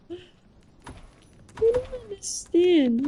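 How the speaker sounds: pleading confusion over the compliment; baffled. Real, not acted. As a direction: stretch it out in disbelief. Protesting partner calls her cute.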